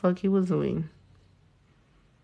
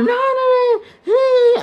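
high mock yell at the TV, much higher; imitating a TV yeller; 6 dB louder, much slower than usual. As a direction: ham up a fan yelling at the screen.

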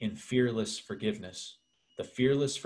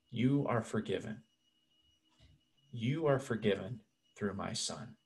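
soft, hushed voice of God; tender reassurance; 3 semitones lower, 9 dB quieter than usual. Acted. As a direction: grant pardon gently and quietly. Preacher voices God granting forgiveness.

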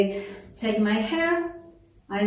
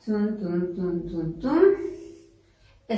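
rhythmic "tun" count; 3 semitones lower, much slower than usual. Shown as a demonstration. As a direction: bounce out the dance beat on "tun". Vocalises the rhythm of steps. ("tun, tun" sung out as a step rhythm)

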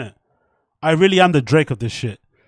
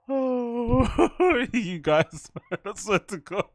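laughing; 8 semitones higher, 4 dB quieter, much slower than usual. Real, not acted. Laughing at viewers.

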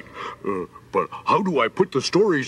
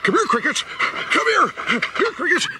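high sing-song call to the crickets; 13 semitones higher than usual. Acted. Weasel calls the crickets to come, high and coaxing. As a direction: coax them over, calling high.

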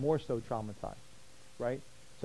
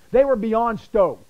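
higher, much louder, slow and emphatic; stoked; 8 semitones higher, 12 dB louder, slower than usual. Real, not acted. Preacher matching the women's joy, excited and loud. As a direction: burst out with real excitement. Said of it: "You think I'm being stoked".

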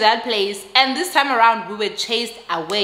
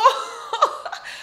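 airy laugh. Real, not acted. Laughing at the absurd eggs.

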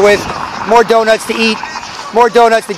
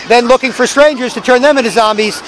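ordinary delivery

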